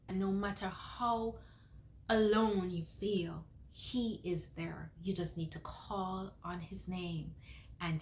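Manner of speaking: soft, slow, heartfelt comfort; slow down and soften, let each promise settle; tender reassurance. Real, not acted. Encouraging viewers that they are not alone, tender.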